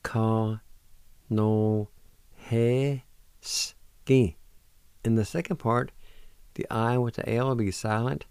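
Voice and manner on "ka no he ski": "Ka no he ski" in separate syllables; syllable-by-syllable pronunciation. Shown as a demonstration. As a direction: sound out "Ka no he ski" with pauses. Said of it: "First part is pronounced like this".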